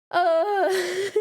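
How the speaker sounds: high, loud laughing burst. Real, not acted. Streamer laughing loudly at a donation.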